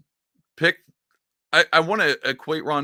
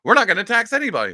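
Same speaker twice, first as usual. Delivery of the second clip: 1 loud mock Trump; 9 semitones higher, 6 dB louder, faster than usual. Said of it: "Trump was like".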